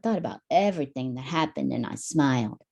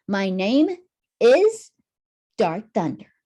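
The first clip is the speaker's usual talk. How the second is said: loud high leap on "is", then a grand name; dramatic hero name; 4 semitones higher, 6 dB louder, slower than usual. Acted. Hero introducing himself to Tommy, dramatic. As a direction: announce yourself like a superhero.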